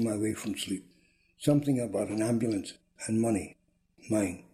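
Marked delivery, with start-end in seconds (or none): none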